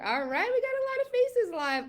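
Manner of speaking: high, bright welcome to the live viewers; excited greeting. Real, not acted. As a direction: lift the voice way up, thrilled to see everyone.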